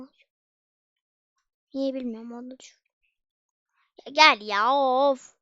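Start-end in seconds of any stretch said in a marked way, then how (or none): 4.1-5.4 loud exasperated groan of a call; frustrated call. Real, not acted. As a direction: call out fed up and sigh it. Frustrated calling at the character.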